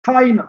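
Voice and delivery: "china" with CHI high and strong, "na" falling away; stress on first syllable. Shown as a demonstration. Teacher giving a noun example, first syllable stressed. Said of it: "पहले वाले सारे stress हैं".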